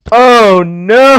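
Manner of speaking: high, loud drawn-out mock scream; over-the-top cry of disaster. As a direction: wail it in hammed-up horror. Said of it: "like Dreamer screaming "no".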